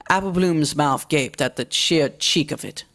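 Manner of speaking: ordinary delivery, nothing marked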